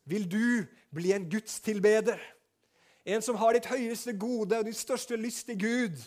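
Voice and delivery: loud, high appeal; preaching at full force. Acted. Pastor appealing to listeners, loud and high. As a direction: press the question home. Altar call.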